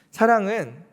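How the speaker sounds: normal talking voice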